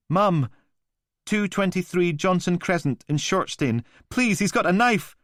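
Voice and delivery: high, loud, pleading fear; terrified caller. Acted. Caller giving her address to the operator, terrified. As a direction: beg for help through panic.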